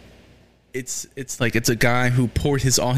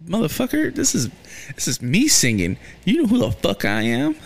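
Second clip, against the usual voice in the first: much higher, hammed-up outrage; mock indignant tirade; 6 semitones higher than usual. Fan scolding the record executive with much higher mock outrage. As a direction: tell him off as if you were her.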